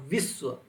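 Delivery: विश्व, leaning on its first syllable; stress on first syllable. Shown as a demonstration. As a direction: force on the वि of विश्व. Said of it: "वी पे क्या है".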